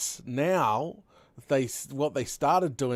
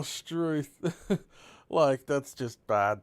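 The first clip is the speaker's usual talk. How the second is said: normal talking voice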